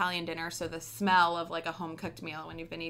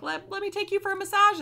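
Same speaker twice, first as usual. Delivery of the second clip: much higher, louder put-on voice; imitated host; 14 semitones higher, 8 dB louder than usual. Imitating a host offering the traveler a treat, high-pitched. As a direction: play an insistent offer in a high voice. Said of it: "someone that's like".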